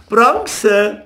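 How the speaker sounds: spiteful jeer. A narrator's reading. Boys catching crayfish jeering at Jakob, spiteful. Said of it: "riefen sie gehässig".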